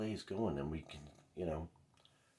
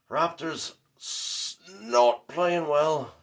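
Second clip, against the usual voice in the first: drawn out slow, higher and louder, mock mourning; mock lament; 10 semitones higher, 12 dB louder, much slower than usual. Fan mourns his team's loss to viewers, slow mock lament. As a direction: wail it out like a hammed-up eulogy.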